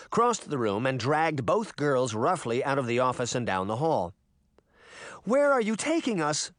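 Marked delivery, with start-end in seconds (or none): 5.2-6.5 high, urgent demand; alarmed child's sharp question. A narrator's reading. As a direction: demand an answer, pitch raised. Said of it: "Sabrina demanded".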